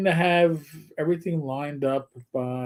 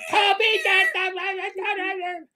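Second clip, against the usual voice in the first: high, slow, drawn-out call at the cat; mock yell; 14 semitones higher, much slower than usual. Sister calling out to the cat Toby, stretched mock yell.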